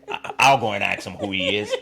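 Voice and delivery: lightly mocking American accent. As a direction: put on an American accent through a laugh. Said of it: "With this American accent".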